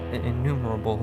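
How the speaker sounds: usual speaking voice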